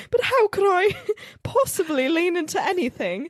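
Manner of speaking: high, hammed-up British voice breaking into laughter; British accent. Student doubting she can lean in, mock British accent. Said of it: "talk in a British accent".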